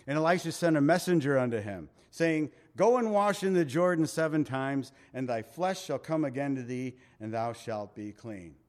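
scripture reading. Acted. Reads scripture aloud.

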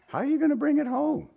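high, quick baffled question; incredulous. Acted. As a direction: ask it high-pitched in mock bafflement. Preacher playfully questions Mary's plan.

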